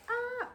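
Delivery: short, very high squeal of "ah"; high-pitched "ah". Real, not acted. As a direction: let out a high, sustained "ah". High little cry mid-vlog.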